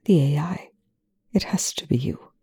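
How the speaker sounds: soft unvoiced whisper. A narrator's reading. Young confiding to Rush in a whisper. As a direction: breathe it secretly. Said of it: "Young whispers".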